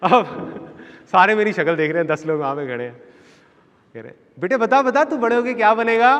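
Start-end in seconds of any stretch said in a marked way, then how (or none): 4.4-6.1 high, loud, eager prompting; coaxing relative. Retold or imitated. Relatives asking child what he will become, high loud coaxing. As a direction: imitate an adult egging a child on.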